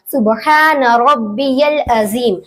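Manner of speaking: slow, drawn-out "सुबहान", y in "रब्बियल", z in "अजीम"; correct recitation. Shown as a demonstration. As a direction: model "अजीम" slowly as a-zeem. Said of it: "इस तरीके से पढ़ना है". (धीरे, "रब्बियल" में "या", "अजीम" में "ज़")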